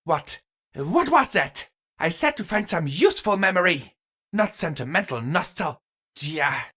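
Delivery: high, loud, fast scornful outburst; scoffing anger. A narrator's reading. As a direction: snap at them in disbelief. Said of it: "The goddess scoffed".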